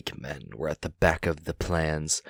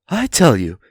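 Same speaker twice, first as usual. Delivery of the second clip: higher, louder, forcing courage; a small hero standing up to a dragon; brave hobbit voice; 7 semitones higher, 11 dB louder than usual. Acted. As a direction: Bilbo plucking up courage to answer Smaug. Bilbo insists loyally.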